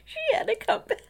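high squeaky words through laughter; real giggling fit over the words; laughing. Real, not acted. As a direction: lose it laughing mid-sentence. Cracking up at the wrong turn.

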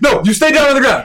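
mock monster voice. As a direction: boss the hero around in a put-on monster voice. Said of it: "He's just like".

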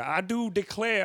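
hammed-up Southern film accent. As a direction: draw it out like a movie Southerner. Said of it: "it's all from films".

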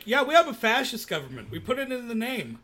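lightly hammed-up national bravado; mock Italians boasting. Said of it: "only people to be like".

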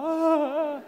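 high, hammed-up fake cry of pain; faked yelp. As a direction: fake a yelp that doesn't hurt. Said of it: "a gente fingia".

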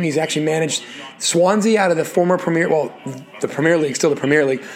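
usual speaking voice

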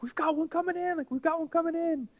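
high imitated call over the radio; excited radio call. Lady radioing the finish line, high excited voice. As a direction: announce the runner with glee. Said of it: "She was so excited".

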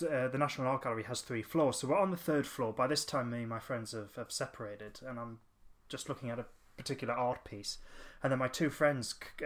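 normal talking voice

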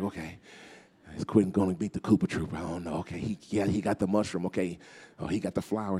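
breathless whisper-watching of the final level; hushed watching. Retold or imitated. Kid on the bed, hushed while a friend plays Mario.

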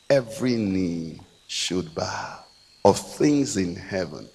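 low, soft, measured preaching; hushed pulpit solemnity with long pauses; solemn scripture recital. Acted. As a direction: recite it slowly and reverently, voice dropped.

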